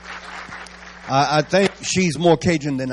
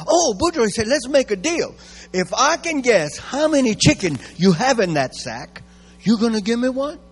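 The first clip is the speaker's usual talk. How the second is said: Thibodeau voice; 7 semitones higher than usual. Acted.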